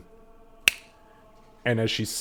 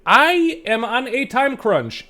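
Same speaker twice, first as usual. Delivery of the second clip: priestess scolds the gnome, higher and louder; stern priestess; 10 semitones higher, 9 dB louder than usual. Acted. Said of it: "She kinda looks over you guys and he goes".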